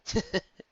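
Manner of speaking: a short soft chuckle. A narrator's reading. Blue Note chuckling softly to reassure the others. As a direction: chuckle gently to yourself. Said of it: "She chuckled softly".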